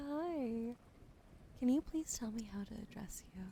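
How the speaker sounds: usual speaking voice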